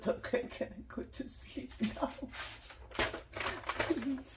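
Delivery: soft, unvoiced, laughing plea; mock pleading whisper. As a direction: beg quietly to be let off. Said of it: "I said".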